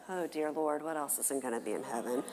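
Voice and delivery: low, quiet mutter to herself; inner dismay. Retold or imitated. Mom's inner dismay echo.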